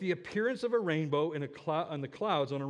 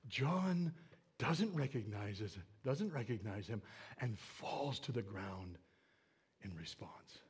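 low voice sinking towards a whisper; slow down and fade away; hushed awe; 8 semitones lower, 9 dB quieter than usual. Acted. Preacher tells of John falling before Jesus, hushed awe.

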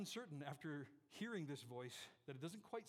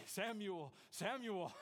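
God calling; 3 semitones higher, 5 dB louder, much slower than usual. Acted. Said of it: "and God says".

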